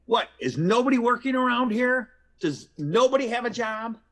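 high, loud shout at the nurse; furious visitor yelling at the nurse; angry scream. Retold or imitated. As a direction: bellow it at the desk. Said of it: "and screams".

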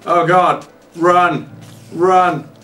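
high, loud frantic run run; panicked yelling. Real, not acted. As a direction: shout it in sheer panic. Spider chasing.

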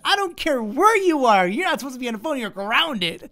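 high, loud scolding mom voice; angry mom. Retold or imitated. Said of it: "And she goes".

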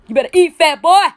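loud taunting cheer. Real, not acted. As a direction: egg him on, loud and slow. Cheering brother on in challenge.

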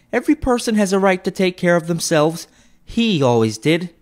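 high, brassy defiance; defiant woman. A narrator's reading. First woman defying the other two, high and bold. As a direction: toss it off boldly.

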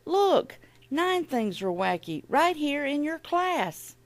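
high-pitched, urgent call to the teacher; acted excited yell; storybook yell. A narrator's reading. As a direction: yell it high, as if reporting trouble. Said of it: "I yelled to Miss Bass".

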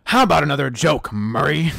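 loud, theatrical villain question; deranged showman taunting the host; Heath Ledger's Joker. Acted. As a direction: throw it out forcefully with menace. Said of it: "in the Heath Ledger voice".

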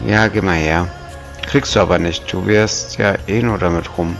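usual speaking voice

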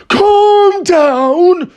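very high, loud outburst; incredulous cry. A narrator's reading. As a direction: shriek it back in disbelief. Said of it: "cried Mister Cranky".

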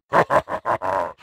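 deep short brute laugh; a quick gloating chuckle; low chuckle. Acted. Brute laughs between taunts.

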